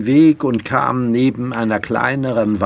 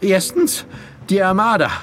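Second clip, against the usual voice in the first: high, breathless warning in short bursts; winded, alarmed boy; gasping for air; 10 semitones higher than usual. Acted. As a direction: pant out the news urgently. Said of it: "noch nach Luft schnappte".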